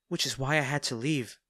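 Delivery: ordinary delivery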